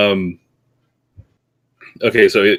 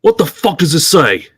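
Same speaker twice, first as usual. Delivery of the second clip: tough interrogator; 9 semitones higher, 5 dB louder, slower than usual. Acted. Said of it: "and go".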